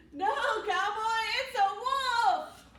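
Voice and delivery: high loud panicked cry; panicked shout. Acted. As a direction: yell it in panic, slowly. Character panics at wolf.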